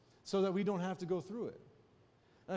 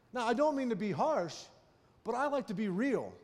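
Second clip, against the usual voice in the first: forceful, higher-pitched sermon delivery; emphatic preaching; 5 semitones higher, 4 dB louder than usual. Real, not acted. Preacher telling listeners to let the past go, preaching emphatically. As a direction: drive each point home to the congregation.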